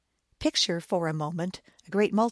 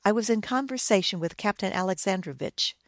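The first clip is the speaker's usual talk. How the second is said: plain reading voice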